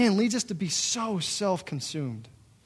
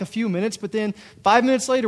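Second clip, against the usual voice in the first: ordinary talk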